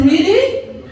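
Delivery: high, rising retold question; incredulous. Worker hears there is no job, incredulous. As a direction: reenact disbelief with a lifted voice. Retells reaction to losing job.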